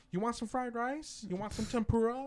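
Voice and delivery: high-pitched offering voice; imitated grandmother. Grandma offering food when he comes home, high eager voice. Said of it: "she'd be like".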